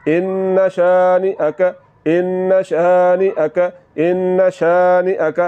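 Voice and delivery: slow ان شانئک with a brief nasal hold on the n; ان شانئک, short nasal hold on the n; slow nasal recitation. Shown as a demonstration. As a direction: hold the n of ان in the nose a moment, then release. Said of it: "آواز کو ناک میں لے جا کر تھوڑا سا لمبا".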